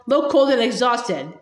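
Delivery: ordinary delivery, nothing marked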